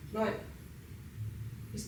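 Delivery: normal talking voice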